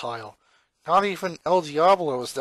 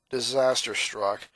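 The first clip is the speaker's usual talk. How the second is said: plain reading voice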